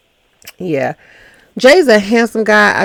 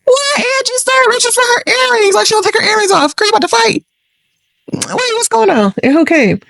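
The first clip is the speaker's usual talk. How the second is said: much higher, laughing in disbelief; excited disbelief; 14 semitones higher, 4 dB louder, faster than usual. Real, not acted. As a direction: react to a fight about to start. Reacting to drama on screen.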